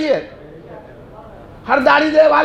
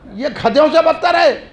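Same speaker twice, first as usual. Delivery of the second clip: ordinary talk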